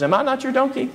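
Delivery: a hint of a wounded animal pleading with its rider; talking donkey. Donkey asks the master who struck it, lightly voiced and hurt.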